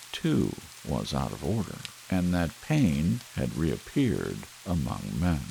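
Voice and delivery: usual speaking voice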